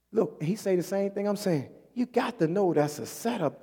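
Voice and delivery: ordinary talk, nothing marked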